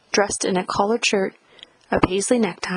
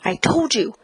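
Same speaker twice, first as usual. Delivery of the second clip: urgent, strained warning, pitch raised; restrained scream-whisper, higher pitched; 6 semitones higher than usual. A narrator's reading. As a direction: warn your child desperately, close to tears. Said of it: "she scream whispered and started to cry".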